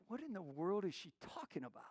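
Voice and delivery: very soft inner wondering; hushed mock bewilderment; baffled aside. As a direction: puzzle it out under your breath. Retold puzzled inner thought.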